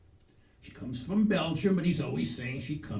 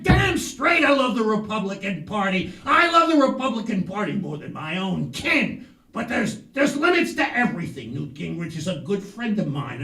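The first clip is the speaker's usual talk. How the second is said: emphatic quote; 4 semitones higher, 10 dB louder than usual. Retold or imitated. [name] quotes Carl emphatically.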